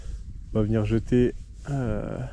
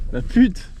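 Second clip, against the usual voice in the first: angry outburst; 8 semitones higher, 6 dB louder than usual. Real, not acted. Frustrated outburst after losing fish.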